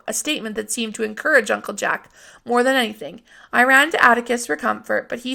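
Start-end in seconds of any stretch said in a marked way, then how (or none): none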